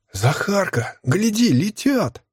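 put-on high little boy calling out, slow; a young lad shouting to his friend; squeaky boy voice. Boy calls out to Zakhar.

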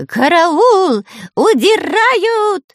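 high, loud panicked shriek. Acted. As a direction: squeal in a voice not your own. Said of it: "не своим голосом заверещал Дырка". (визг не своим голосом)

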